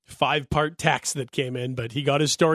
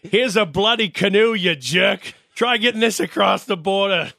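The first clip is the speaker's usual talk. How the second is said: higher-pitched mock announcer with a Yankee accent; 5 semitones higher than usual. Mock announcer presenting Jeter's farewell gift, Yankee accent. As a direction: put on a Yankee announcer's ceremonial voice. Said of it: "had some sort of a Yankee accent".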